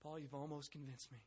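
soft, subdued quote; sincere admission. Acted. Agrippa admitting to Paul he is nearly convinced, soft. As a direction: say it quietly, as if nearly won over. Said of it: "just as it's translated here".

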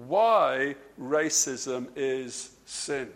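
slow emphasis on "why racism is sin"; emphatic. Real, not acted. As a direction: weigh each word of "why racism is sin", slowly and firmly. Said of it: "I've said that emphatically".

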